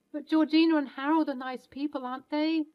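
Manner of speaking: put-on a little boy checking whether his grandparents are nice; hopeful child. Child asks about grandparents.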